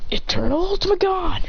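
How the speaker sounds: high-pitched anime power call; mock battle cry without full volume; attack call. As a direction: announce a special move in mock battle. Power move called in fight.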